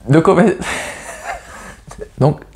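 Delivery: breaking up into helpless laughter; laughing fit. Real, not acted. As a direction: try to restart the sentence and crack up. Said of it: "je rigole parce que". (pris de fou rire)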